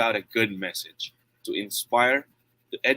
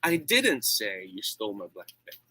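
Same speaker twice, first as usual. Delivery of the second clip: "didn't" pushed up in pitch, the rest dropping; emphatic "didn't", then trail down; stress on DIDN'T. Shown as a demonstration. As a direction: hit "didn't" firmly as a denial. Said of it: "if I say it like this".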